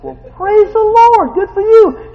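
high, loud, slow joyful praise; excited praise. Retold or imitated. Preacher congratulating the deli worker, high and joyful. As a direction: burst out in delight for her.

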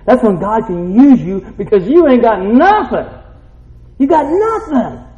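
loud preacher's punch; emphatic preaching. Real, not acted. Preacher telling them God can use them, emphatic. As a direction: drive it home to the congregation with force.

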